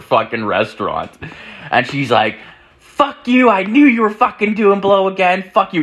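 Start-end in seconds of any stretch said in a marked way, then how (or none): 2.8-5.8 high, loud imitated yelling; mock a bride screaming at her husband; hammed-up, high-pitched angry woman; furious wife. Wife yells at husband, retold.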